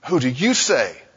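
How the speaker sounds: "you" picked out with a rise, then falling; stress on YOU. Shown as a demonstration. Preacher pointing to the individual, stress on YOU. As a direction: make "you" the point of the question. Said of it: "Notice the emphasis on the individual".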